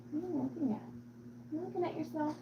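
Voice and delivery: high, soft cooing; small doting high voice; soft pet talk. Real, not acted. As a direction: coo quietly at the cat. Cooing to the cat.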